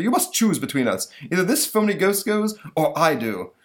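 high, loud, demanding monster; ultimatum monster voice. Acted. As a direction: lay down the ultimatum. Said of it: "says the monster".

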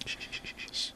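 soft, voiceless shushing; hushing. Real, not acted. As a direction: shush the room urgently for a phone call. Hushing while the phone rings.

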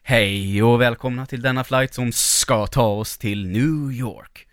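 drawn-out pilot cabin welcome; pilot announcement. Acted. Host shows how pilots talk, slow pilot announcement voice. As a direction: stretch the words like an airline captain. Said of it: "Så säger piloten".

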